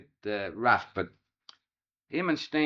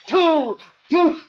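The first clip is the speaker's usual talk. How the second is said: much higher and louder, yelling "Two! Two!"; shouting match; 12 dB louder than usual. Retold or imitated. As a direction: hurl it back and forth like a street slanging match. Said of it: "they're spitting at each other all the way".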